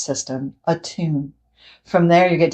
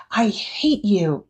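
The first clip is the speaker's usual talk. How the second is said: put-on child's hurt line; 3 semitones higher, slower than usual. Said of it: "and yells".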